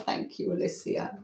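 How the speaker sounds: a breathless whispered thank-you. Real, not acted. Host thanking a viewer for a gift, whispered. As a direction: whisper it as a quick aside.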